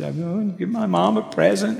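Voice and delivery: high-pitched tearful little boy, imitated; mock crying. Little boy explains his mom's present to a stranger, mock crying. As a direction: imitate a child wailing through tears. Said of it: "he's crying by now".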